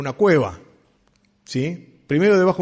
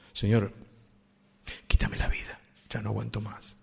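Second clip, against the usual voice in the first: weary despair; 3 semitones lower, 6 dB quieter than usual. Acted. Elijah despairing under the juniper.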